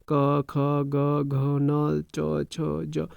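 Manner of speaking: क, ख, ग each said slowly and apart; slow recitation of consonants. Shown as a demonstration. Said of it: "हर एक व्यंजन को अगर पढ़ते जाएंगे". (व्यंजन धीरे-धीरे बोलना)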